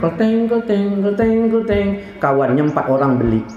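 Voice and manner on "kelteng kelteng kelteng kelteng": slow sing-song clanging imitation; ringing, evenly repeated "kelteng"; ice cream seller's bell. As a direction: imitate a vendor's bell on one held note. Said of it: "Lewat tukang es krim".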